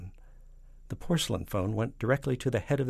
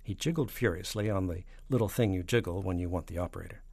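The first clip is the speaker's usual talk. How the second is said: ordinary delivery, nothing marked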